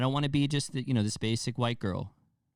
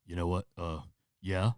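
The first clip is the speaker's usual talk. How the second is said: low, hesitant villain attempt; badly faked menacing killer; scary-voice retry; 4 semitones lower than usual. Shown as a demonstration. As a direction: fumble toward a scarier, lower voice. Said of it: "Let me try it again".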